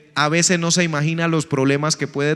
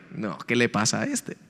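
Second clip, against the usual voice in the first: dismissive scoff; 9 semitones lower, 9 dB quieter than usual. Retold or imitated. Uno en lugar de Dios.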